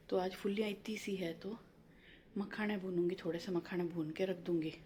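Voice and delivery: whispering quietly. Real, not acted. To viewers while husband sleeps, soft whisper. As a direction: keep it down so nobody wakes. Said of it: "इसीलिए मैं थोड़ा सा धीरे धीरे बोल रही हूँ".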